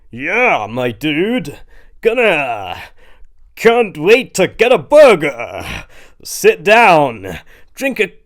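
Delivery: loud, slow, hammed-up American bro; fake American accent. A narrator's reading. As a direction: overdo the American party accent. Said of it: "put on his best American accent".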